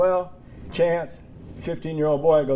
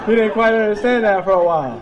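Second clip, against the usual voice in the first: loud, higher, laughing as he talks; 8 semitones higher, 9 dB louder than usual. Real, not acted. Dad recalling his son's confusion, real laughter. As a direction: carry the laugh through the words.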